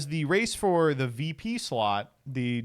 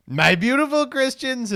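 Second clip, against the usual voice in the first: lightly mimicked Trump line, high and loud; quoting Trump; 9 semitones higher, 5 dB louder than usual. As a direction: echo the phrase in a hint of a Trump voice. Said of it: "quoting Trump line".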